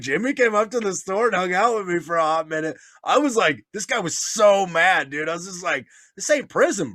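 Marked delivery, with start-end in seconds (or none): 6.2-6.9 retold indignant objection; acted protest. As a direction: push back at him, pitched up. Retelling his protest to angry man.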